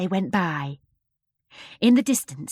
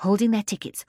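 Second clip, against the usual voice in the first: usual speaking voice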